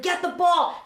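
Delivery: high, loud exasperated command; sideline shouting. Retold or imitated. Grandfather orders granddaughter on the field, loud high yell. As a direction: imitate grandpa yelling across the field.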